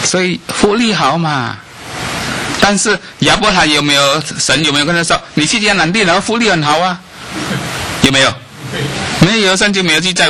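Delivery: high preaching questions; sermon rhetoric. Acted. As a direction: challenge the congregation.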